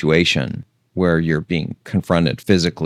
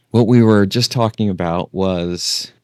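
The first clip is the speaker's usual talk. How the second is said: normal talking voice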